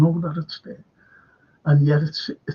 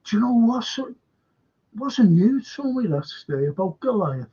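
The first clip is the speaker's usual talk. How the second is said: normal talking voice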